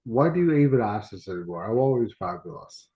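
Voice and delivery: normal talking voice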